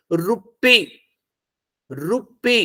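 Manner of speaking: रुप्पी split slightly, पी held long; careful model रुप्पी, stressed at the end; stress on last syllable. Shown as a demonstration. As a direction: lean on पी in रुप्पी. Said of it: "आप बोल के देख लो".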